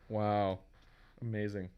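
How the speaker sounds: normal talking voice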